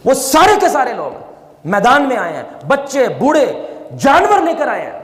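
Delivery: high, urgent sermon climax; impassioned preaching. Acted. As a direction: preach the crying crowd with rising fervour. Rising story of Yunus' people.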